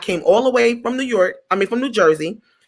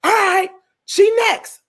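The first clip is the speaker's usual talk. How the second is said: high, slow, curt bossy lady; mean director calling next, high and slow; 12 semitones higher than usual. Retold or imitated. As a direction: play her as a haughty diva. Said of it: "real mean".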